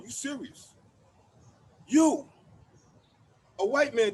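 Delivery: normal talking voice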